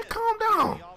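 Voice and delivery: very high mock "calm down"; squeaky mock scolding. Real, not acted. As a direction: scold yourself in a silly high voice. Telling himself to settle down.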